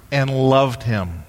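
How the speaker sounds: ordinary talk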